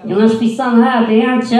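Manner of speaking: put-on high, loud condescending old lady; mocking a patronizing old lady at the mall. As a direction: talk down to him like a child, sugary and loud. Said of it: "she's like".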